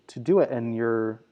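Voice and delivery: usual speaking voice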